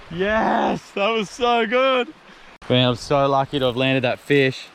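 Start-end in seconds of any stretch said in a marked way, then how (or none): 0.1-2.1 higher and slow, savouring the win; a joyful, drawn-out cheer; elated. Real, not acted. Joy after the release.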